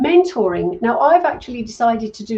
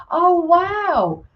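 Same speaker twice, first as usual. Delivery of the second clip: high, drawn-out amazement; amazed reaction; 8 semitones higher, 3 dB louder than usual. Real, not acted. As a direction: let the wow swoop up high in amazement. Reacting to viewer's surprising news.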